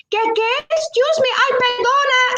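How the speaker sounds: very high, startled mimicked voice; apologising older woman. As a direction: imitate a flustered Spanish-speaking woman apologising. Acts the flustered older woman apologising.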